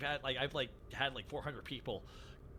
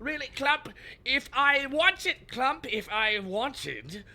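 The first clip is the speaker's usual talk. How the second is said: King K. Rool voice; 6 semitones higher, 6 dB louder, slower than usual. Retold or imitated. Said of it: "he's just like".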